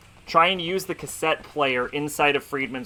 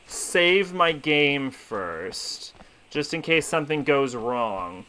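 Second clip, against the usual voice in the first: ordinary talk, nothing marked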